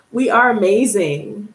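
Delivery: ordinary delivery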